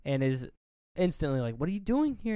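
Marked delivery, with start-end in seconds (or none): 1.6-2.3 higher, mock-startled question; startled girl, retold. Retelling a girl finding her boyfriend at the window, mock startled. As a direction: lightly act out her alarm.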